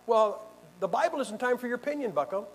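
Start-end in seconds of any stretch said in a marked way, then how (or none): none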